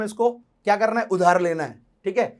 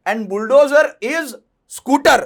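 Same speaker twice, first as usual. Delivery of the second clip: slow, louder, drawn-out build to scooter; dramatic reveal; 4 semitones higher, 7 dB louder, much slower than usual. Acted. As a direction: stretch it out, then hit scooter louder and higher. Reveals absurd code word dramatically.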